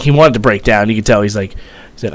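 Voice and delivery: ordinary delivery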